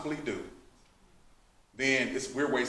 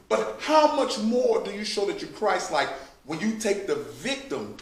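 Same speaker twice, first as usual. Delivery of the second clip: ordinary talk, nothing marked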